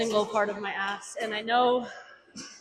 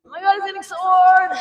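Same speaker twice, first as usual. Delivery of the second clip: high, loud strained yell; strained shout; 8 semitones higher, 9 dB louder than usual. Real, not acted. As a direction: cry out mid rep.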